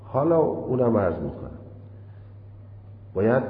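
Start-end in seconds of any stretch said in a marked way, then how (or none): none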